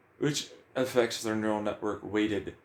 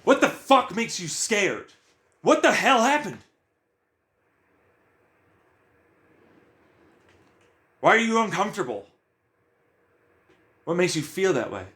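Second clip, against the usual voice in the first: high, loud, pressing questions; probing shout; 7 semitones higher, 4 dB louder than usual. Acted. Questioning his own mind about its anger, high and loud. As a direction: demand answers from yourself.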